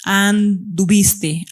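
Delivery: "anduviste" broken after "and", pause, then "duviste"; very slow "anduviste", the stem held apart; stem split off. Shown as a demonstration. Teacher shows the unchanged stem of a verb, "anduviste" split after "and". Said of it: "el lexema está invariable".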